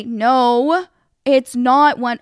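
loud retold contradiction; emphatic, fed-up denial; getting mad. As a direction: shut it down, exasperated. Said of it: "I was getting so mad".

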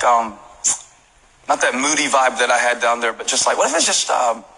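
ordinary talk

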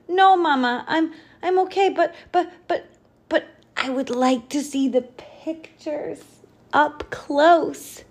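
put-on high, hesitant child voice; stammering little bat. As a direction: stumble over the words.

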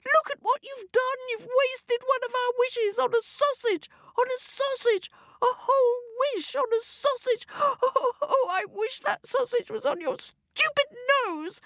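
high-pitched exasperated tirade; hammed-up angry wife in a very high voice; scolding wife. A narrator's reading. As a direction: nag and wail at your husband. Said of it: "called Missus Smith".

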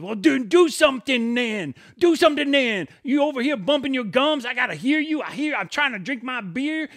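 screaming taunt. Retold or imitated. Little friend screaming at the drunk to act, taunting. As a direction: scream a dare at a bigger man. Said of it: "starts slamming".

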